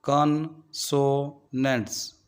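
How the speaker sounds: Consonants slowly in three parts, कॉन, सो, नेंट्स; slow teacher's syllable division of consonants. Shown as a demonstration. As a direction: break consonants into three with clear pauses. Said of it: "ये तीन syllable पर".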